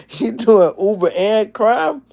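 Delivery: high, slow, drawn-out disbelief; shocked mock repeat of the viewer's claim; incredulous. Real, not acted. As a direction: stretch it out like you can't believe it.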